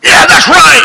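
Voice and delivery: loud, high hammed-up cheer of a radio voice; sudden burst of agreement from the car radio; mock radio shout. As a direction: blast it like a fired-up talk show host. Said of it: "I'm like".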